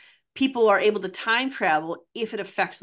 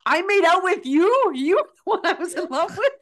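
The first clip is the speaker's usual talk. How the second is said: high-pitched, incredulous mock outcry; appalled disbelief; 9 semitones higher, 3 dB louder than usual. Host voices a man's horror at his teenage ex, appalled disbelief. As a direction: imitate a horrified man, pitched up and half laughing.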